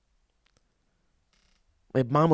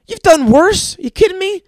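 incredulous; 7 dB louder than usual. Acted. Incredulous retort to listeners.

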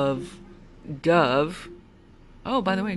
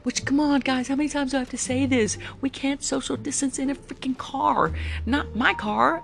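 higher-pitched, exasperated rant; exasperated scolding; 7 semitones higher than usual. Real, not acted. Driver fed up with riders not masking, exasperated. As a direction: plead in exasperation, voice lifted.

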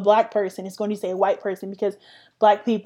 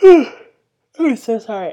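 yawning mid sentence; 9 dB louder, much slower than usual. Real, not acted. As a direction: talk through a yawn. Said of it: "I don't know why I just yawned".

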